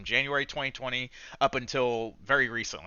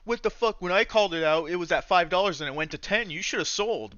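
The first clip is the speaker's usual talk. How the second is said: higher-pitched mock outrage at followers; mocking smug pumper; 5 semitones higher than usual. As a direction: sneer at them in a hammed-up voice. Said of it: "They'd go on here and be like".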